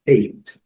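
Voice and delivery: eight said as /eit/; English eight. Shown as a demonstration. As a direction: clear model word Eight. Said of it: "a pronúncia do número oito em inglês". (pronúncia modelo de Eight)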